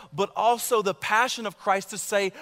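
normal talking voice

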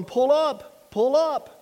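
mock automated cockpit alarm; mechanical voice. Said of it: "in the mechanical voice".

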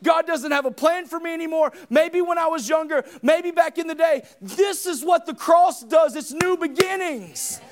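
loud, high preaching cry; full-force preaching. Acted. Preacher shouting to the congregation about the cross. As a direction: preach it out to the whole room.

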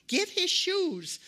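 high, loud, slow command; mother yelling. Retold or imitated. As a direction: drag out the order. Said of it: "his mother the whole time is yelling".

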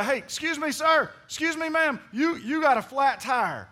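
urgent but polite shout-out; calling out. Acted. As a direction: call over to someone in the next car. Acts out hailing a driver.

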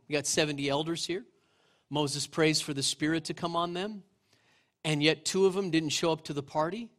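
ordinary talk, nothing marked

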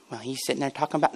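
low, hushed, fast aside as an objector; quick, quiet mock complaint; mimicked grumbler. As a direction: mimic a listener grumbling under their breath.

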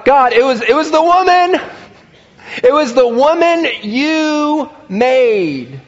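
high, loud whining excuse, dragging out "woman"; whiny Adam excuse. Acted. Adam excusing himself to God, a high loud whine. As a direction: plead your case in a high, pleading whine.

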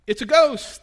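high, loud frightened cry; terrified disciples on the lake, crying out loud and high; cried out in fear. Retold or imitated. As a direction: yell it like you've seen a ghost. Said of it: "cried out in fear".

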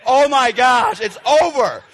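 ordinary delivery

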